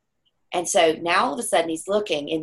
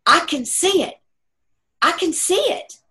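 high, louder, wondering voice of the dying father; awed dying father; 12 semitones higher, 5 dB louder than usual. Retold or imitated. Son voicing his dying father seeing a vision, high and awed. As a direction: reach up and marvel, voice lifted high. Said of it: "and he says".

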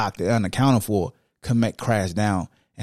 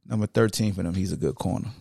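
ordinary talk, nothing marked

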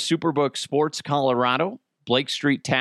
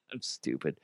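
ordinary delivery

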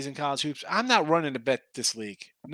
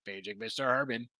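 quiet flat PA announcement; flat echoey pa page; 4 semitones lower, 9 dB quieter than usual. Retold or imitated. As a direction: read it like a hotel paging system. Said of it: "It's like".

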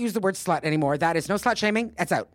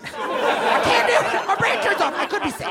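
mock panic; 3 dB louder than usual. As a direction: squeal in hammed-up helplessness. Flustered after failed British accent.

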